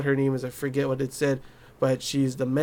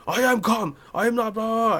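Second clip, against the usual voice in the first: mock agitated insistence; 6 semitones higher than usual. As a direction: get in someone's face with a high, hammed-up protest. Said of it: "he gets all, like, in".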